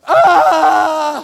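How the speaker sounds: loud, high scream of alarm; sudden hammed-up yell of fright; panicked scream. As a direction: scream as if just caught. Panic when police lights come on.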